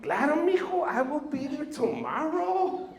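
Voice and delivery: put-on high, slow and eager older man; a hint of a cheerful Mexican uncle; Tio Chuy voice. Tio Chuy answers nephew's call, high and eager. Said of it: "Tio Chuy, can you do my concrete?".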